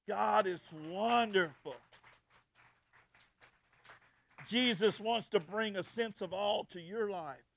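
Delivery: loud, slow proclamation; preaching. Acted. Preacher declaring God is wonderful to the congregation, preaching loud and slow. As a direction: proclaim it with drawn-out weight.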